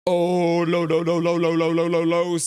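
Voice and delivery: rising game panic; anxious excited no's. Real, not acted. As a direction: plead with the play on screen. Tense moment in the game.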